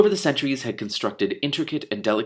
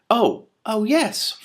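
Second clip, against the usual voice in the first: higher, slowly dawning oh; sudden realization; 13 semitones higher, 4 dB louder, much slower than usual. Acted. Two Flower remembering Hrun, surprised and higher. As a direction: catch it all at once.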